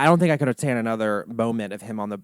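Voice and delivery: ordinary delivery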